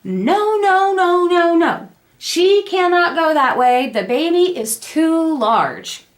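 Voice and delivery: high, emphatic string of refusals; retold adamant objection; insistent doctor. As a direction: firmly overrule them. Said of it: "my O B insists".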